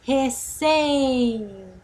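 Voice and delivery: very slow "re-CEEEI-ta"; lingering middle syllable. Shown as a demonstration. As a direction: draw the CEI of "Receita" right out. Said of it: "É a que nós demoramos mais tempo pra falar".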